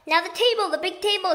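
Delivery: excited shouting; shouted. A narrator's reading. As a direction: call out the table over the noise. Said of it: "shouted Mugglewump".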